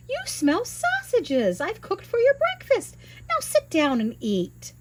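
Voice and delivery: high bossy housewife voice; giant's wife. Acted. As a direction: shoo him off like a busy housewife. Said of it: "the giant's wife said".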